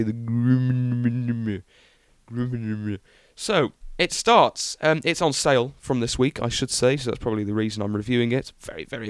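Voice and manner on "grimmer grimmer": very slow, drawn-out mock presenter rumble on "Grimmer"; Jeremy Clarkson. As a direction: stretch "Grimmer" into a long droning hum, imitating a car-show host. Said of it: "as Jeremy Clarkson would say".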